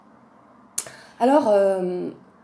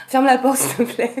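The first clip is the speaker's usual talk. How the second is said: calling out; 5 semitones higher, 6 dB louder than usual. Real, not acted. Calls after leaving friend.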